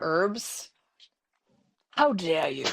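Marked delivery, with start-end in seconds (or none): 1.9-2.7 a playful posh English "how dare you"; mock-offended English accent. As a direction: put on an English accent in jest. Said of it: "I should not try to do an English accent".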